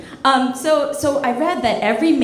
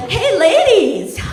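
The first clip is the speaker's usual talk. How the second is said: slow, high, louder showman call to the ladies; drawn-out stage hello; hammed-up greeting; 9 semitones higher, 6 dB louder, slower than usual. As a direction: greet the crowd like a cheesy host. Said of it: "I'd be like".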